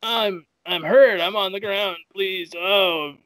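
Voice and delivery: high pleading whimper, slowed; hammed-up begging loser; pleading hurt pilot. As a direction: beg for mercy, mock weak. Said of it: "he's like".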